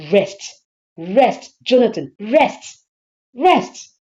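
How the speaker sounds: forceful, escalating echo; emphatic rebuke. Acted. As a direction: throw the daughter's words back at your husband. Wife echoes daughter's rebuke.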